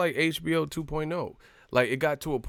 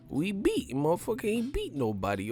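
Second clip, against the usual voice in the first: high, softer mock-dismissive voice; Roger Mayweather; 7 semitones higher, 5 dB quieter than usual. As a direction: imitate a scornful old boxer, pitched up. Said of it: "And then Roger said".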